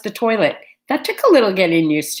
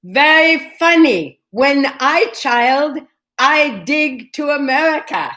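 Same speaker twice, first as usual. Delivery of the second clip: imitating a thick Chinese accent, higher, louder and slow; higher, slow Chinese-accented English, a fond imitation; 4 semitones higher, 3 dB louder than usual. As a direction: mimic the Chinese man on the train in his thick accent, slowly. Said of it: "in a very thick accent".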